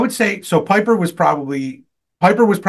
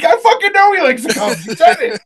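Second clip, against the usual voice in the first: very high, louder imitated outburst; retold exasperation; 5 dB louder than usual. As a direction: re-enact a friend's flabbergasted reply, voice shooting up. Retells Joey's exasperated reply.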